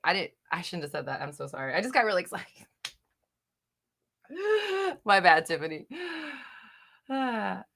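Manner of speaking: normal talking voice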